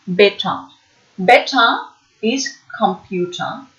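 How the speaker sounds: ordinary delivery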